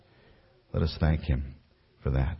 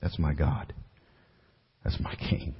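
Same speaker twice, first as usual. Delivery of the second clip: unvoiced reverent whisper; 5 semitones lower, 6 dB quieter than usual. Real, not acted. Pastor closing sermon about his King, whispered. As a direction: breathe it out as a whisper.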